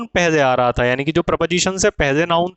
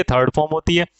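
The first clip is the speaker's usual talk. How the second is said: normal talking voice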